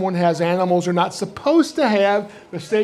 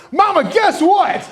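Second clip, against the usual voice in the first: high, stretched-out excited call; excited kid; 11 semitones higher, 4 dB louder than usual. Retold or imitated. Boy bursting in to his mama, thrilled call. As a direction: shout it like a thrilled seven-year-old.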